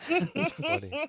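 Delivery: high-pitched words through real laughter; laughing. Real, not acted. Game master tickled by the mushroom description, laughing. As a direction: squeeze it out mid-laugh, slowly.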